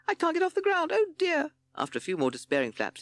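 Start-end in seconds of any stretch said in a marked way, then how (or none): 0.1-1.5 very high, dismayed bat voice; squeaky bat. A narrator's reading. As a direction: squeak it out in despair. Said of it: "squeaky voice".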